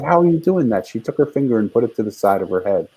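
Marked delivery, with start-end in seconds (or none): 0.0-0.9 pitched high in amazement; surprised exclamation. Retold or imitated. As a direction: exclaim it, stunned. Retold amazement at daughter.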